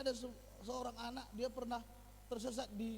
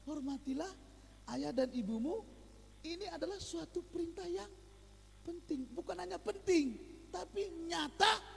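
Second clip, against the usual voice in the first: ordinary talk, nothing marked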